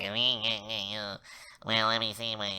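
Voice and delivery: exaggerated nasal self-mockery; mock your own voice as annoyingly nasal; nasally. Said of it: "how nasally my voice is".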